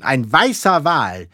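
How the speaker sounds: loud, high captain's commands; calling orders across the deck; rousing orders. A narrator's reading. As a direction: urge the lookouts on, building to a cry. Captain calling orders to his crew.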